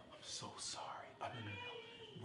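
breathy whispered show of pity, slowing down; fake sympathetic whisper. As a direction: whisper false comfort to the crier. Fake coworkers consoling crier.

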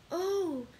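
high, eager exclamation; breathless-with-hope young woman's question; joyful cry. A narrator's reading. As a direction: cry out in sudden hope, pitch leaping up. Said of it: "cried Julie".

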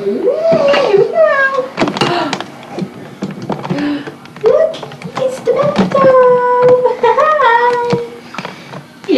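high, slow excited coo; excited baby talk. Acted. Mom showing baby the bathtub, high excited coo. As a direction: make the bath sound thrilling for the baby.

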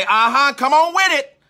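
high, loud urging call; rising church call-back; congregant egging on. Retold or imitated. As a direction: hype the preacher along from the pew. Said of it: "They might say".

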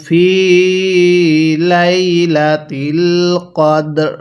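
slow, drawn-out melodic tilawat; Quran recitation. Acted. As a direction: recite with long, measured vowels. (ধীরে সুর করে কুরআন তিলাওয়াত)

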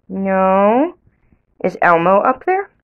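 ordinary delivery

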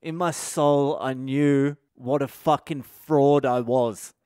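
normal talking voice

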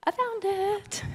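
high, pleased little exclamation; real relief at finding it; delighted. Real, not acted. Finds lost item mid-speech.

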